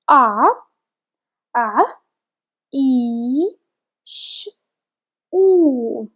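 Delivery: Aishu broken into its sounds; sounding out. Shown as a demonstration. Teacher sounding out Aishu. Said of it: "We have letter alif".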